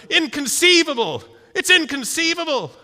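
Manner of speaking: loud, high outraged cry of disbelief; high, loud Vizzini yelp; Vizzini shouting. Retold or imitated. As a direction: shout it like a flustered movie villain. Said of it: "He's always running around going".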